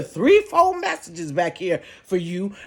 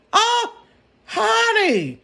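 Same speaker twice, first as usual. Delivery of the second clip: very high drawn-out "Oh, honey"; exclaim; 14 semitones higher, slower than usual. Real, not acted. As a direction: shoot way up in outrage. Exclaiming at the outrage.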